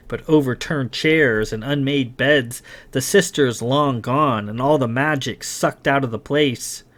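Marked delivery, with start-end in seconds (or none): none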